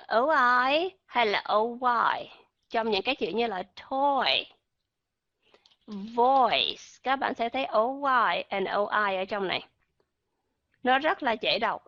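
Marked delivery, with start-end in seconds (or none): none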